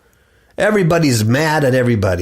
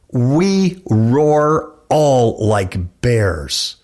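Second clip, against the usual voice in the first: very slow, weighty verse; slow scripture reading; 3 semitones lower, much slower than usual. Acted. Preacher reads the bear verse, very slow. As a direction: stretch every word out.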